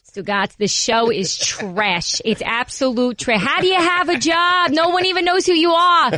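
put-on brash New Jersey mom accent, building up; New Jersey mom scolding, rising at the end; mom's Jersey voice. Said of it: "in her mom's Jersey voice".

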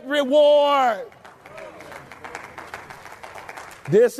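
stretched-out climactic sermon delivery; full-force preaching. Acted. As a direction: lift the promise up and drive every line home.

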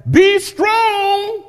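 high ringing command; exhortation. Acted. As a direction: lift them up with it. Exhorting the congregation.

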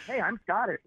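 imitated breezy opener; mock introduction. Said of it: "don't you open with".

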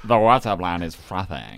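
low mock American-South African tech-bro voice. Host showing how the impression sounded, mock American-South African tech bro. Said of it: "I sound like an American South African tech bro".

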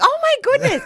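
very high-pitched fangirl gasp of words; starstruck gush. Retold or imitated. Retelling meeting the singer, starstruck and high. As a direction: imitate being overawed, pitch leaping up.

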